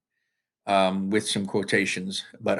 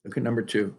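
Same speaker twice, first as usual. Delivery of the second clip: ordinary talk, nothing marked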